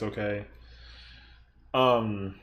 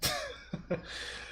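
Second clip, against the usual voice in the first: high breathy laugh; 10 dB quieter than usual. Real, not acted. Laughing at own joke.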